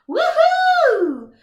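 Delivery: big excited woohoo; whooping cheer. Acted. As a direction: cheer like winning a prize. Thrilled about new camera.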